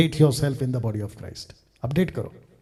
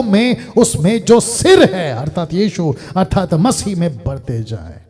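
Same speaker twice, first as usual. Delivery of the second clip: raised, declamatory scripture reading; preached scripture; 7 semitones higher, 8 dB louder than usual. Real, not acted. Preacher reading scripture to the congregation, declamatory preaching. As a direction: proclaim the verse with stressed key words.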